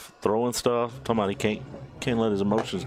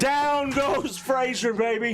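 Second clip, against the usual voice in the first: high, slow stretched call; excited slow call; 14 semitones higher, slower than usual. Real, not acted. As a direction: stretch it out for the big sack. Celebrating the sack.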